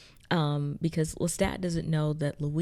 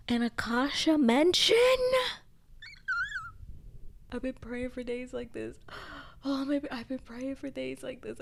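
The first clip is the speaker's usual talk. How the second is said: high, giddy disbelief; high excited fan gush; fangirl squeal; 8 semitones higher than usual. Real, not acted. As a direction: squeal with delight. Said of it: "I yelled and I screamed".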